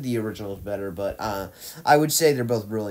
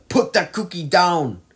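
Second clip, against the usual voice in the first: put-on loud, high yell in a poor Austrian accent; bad Austrian accent; 8 semitones higher, 8 dB louder, slower than usual. Quoting a famous Jingle All the Way line, bad Austrian accent. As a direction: bellow an order in a hammy Austrian accent. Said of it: "that Austrian accent really sucked".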